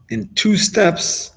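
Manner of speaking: slow, lifted "two steps", soothing a weeping friend; drawn-out reassurance. Acted. Rabbi Shimon reassuring crying Rabbi Yishmael, slow and lifted.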